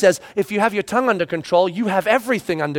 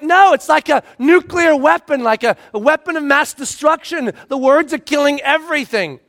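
higher, louder frantic protest; preacher complaining to God that his words destroy; exasperated complaint; 7 semitones higher, 4 dB louder than usual. Retold or imitated. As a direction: overwrought moaning. Despairing reply to God.

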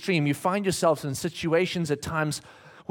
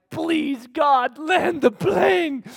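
high pleading cry, slowing to a shout; desperate plea; 7 semitones higher, 5 dB louder, much slower than usual. Retold or imitated. Worn-out dad praying mid-flight, high desperate shout. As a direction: beg God out loud, drawn out. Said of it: "you're like".

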